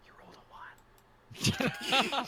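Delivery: tiny secretive voice in the head; hushed inner voice. Acted. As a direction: barely voice it, pausing before the last word. Voice heard in praying head.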